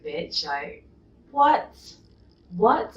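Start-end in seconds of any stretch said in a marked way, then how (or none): 1.3-3.0 sharp high incredulous what; can't believe what she just read; outraged disbelief. Real, not acted. Reading a hateful comment aloud, stunned outraged disbelief.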